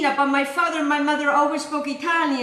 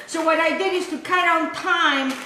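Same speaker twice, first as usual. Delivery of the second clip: normal talking voice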